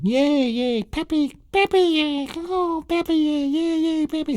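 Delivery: put-on very high squealing child voice; excited little kid. Little kid cheering at dad with his newspaper, very high squeal. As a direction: squeal like a delighted toddler. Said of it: "the little kid is saying".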